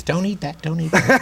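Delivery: squawking parrot. Acted. Guest acts out his cooking-show parrot, squawking high. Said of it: "a parrot that says".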